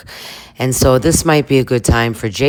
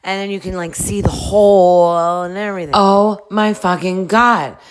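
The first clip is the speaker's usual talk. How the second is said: higher, whiny girl voice, a bit put-on; dubbed gossiping girl; 7 semitones higher than usual. A girl on the bus snapping at Angelica, lightly voiced. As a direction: voice the catty girl in the back of the bus. Dubbing girls on a reality show.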